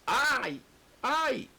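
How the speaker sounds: high, whining sigh of complaint. Retold or imitated. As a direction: moan it like a reluctant servant. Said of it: "otra vez".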